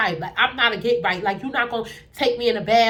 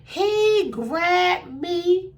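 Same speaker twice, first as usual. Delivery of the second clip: usual speaking voice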